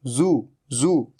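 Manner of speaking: जू stretched slowly with a long buzzing z; slow z drill. Shown as a demonstration. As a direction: drill जू very slowly, z sound held. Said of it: "जू नहीं".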